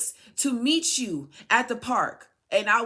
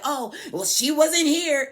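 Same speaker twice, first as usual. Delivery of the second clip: mocking stepmother; 7 semitones higher, 7 dB louder than usual. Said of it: "your stepmother always made sure she's like".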